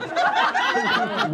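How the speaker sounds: a real laugh that jumps far above his speaking voice; high squealing laugh at the irony; high-pitched laugh. Real, not acted. Laughs at picking cotton.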